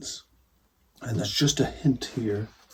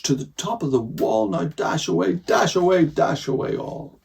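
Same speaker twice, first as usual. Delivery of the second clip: louder and higher, calling out names; shouting to reindeer; 6 semitones higher, 7 dB louder than usual. Acted. Santa calling his reindeer from the sleigh, shouted. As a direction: call the reindeer on like a driver. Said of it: "he whistled and shouted and called them by name".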